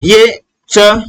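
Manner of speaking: letter च drawn out very slowly; slow letter demo. Shown as a demonstration. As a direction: sound out च slowly and deliberately for the class. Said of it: "इसका उच्चारण करना है".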